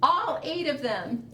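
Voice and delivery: put-on reading to children. A narrator's reading. Reads picture book aloud.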